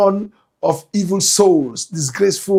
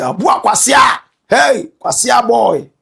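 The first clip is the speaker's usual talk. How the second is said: loud, higher-pitched mock insult; mock heckler's shout; 5 semitones higher, 6 dB louder than usual. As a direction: call out like a heckler insulting the host. Imagined viewer hurling an insult.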